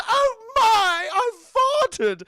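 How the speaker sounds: very high, loud wail; mock British dismay. Girl sits on whoopee cushion, high mock-dismayed wail. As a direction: wail in hammed-up embarrassment.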